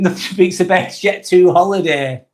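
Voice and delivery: ordinary talk, nothing marked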